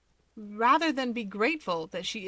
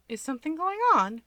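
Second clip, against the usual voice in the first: a light, higher touch of worry; worried; 8 semitones higher, 3 dB louder than usual. Acted. Sister fretting over her brother's fight, mildly worried. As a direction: ask it gently anxious, a little up. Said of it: "Fuyumi worries".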